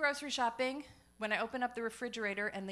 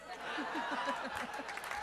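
gleeful laugh; 5 semitones higher than usual. Real, not acted. Punchline self laugh.

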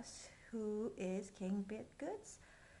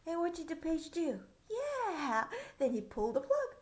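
plain reading voice

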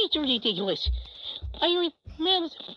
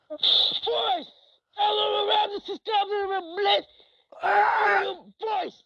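higher, hammed-up call to the boys; special voice; 8 semitones higher than usual. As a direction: belt it out in a silly put-on voice. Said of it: "My favorite special voice!".

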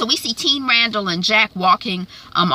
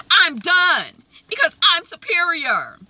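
smug superiority; 9 semitones higher than usual. Acted. Mocking Randall's superiority.

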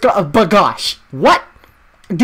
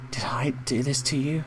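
whisper; 6 semitones lower, 9 dB quieter than usual. Acted. Reading guilty character line.

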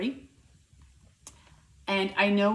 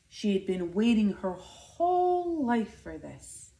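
ordinary delivery

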